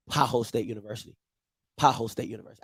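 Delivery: Pajot State University muttered low and soft; practicing the name. Shown as a demonstration. Host rehearsing the new team name in the mirror, soft and low. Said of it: "I gotta get in the mirror and just practice".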